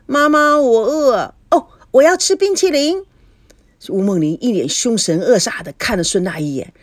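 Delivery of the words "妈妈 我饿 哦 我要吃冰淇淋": put-on high, whining child's demand; loud pleading. A narrator's reading. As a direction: nag mom loudly in a child's voice. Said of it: "大声地叫着". (孩子大声撒娇嚷嚷)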